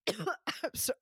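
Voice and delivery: coughing and choking; choked voice, clearing throat; choking on a drink. Real, not acted. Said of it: "now I'm choking again".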